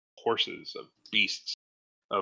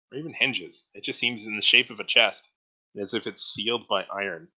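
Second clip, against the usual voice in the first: ordinary delivery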